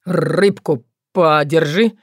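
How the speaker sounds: gloomy Leland. A narrator's reading. Leland answers his ex-fiancée gloomily, low slow stammer. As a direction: answer her flatly, stumbling on words.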